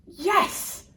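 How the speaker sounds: high, loud cheer of victory. Acted. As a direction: shout it like you finally won. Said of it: "cheered Leonardo".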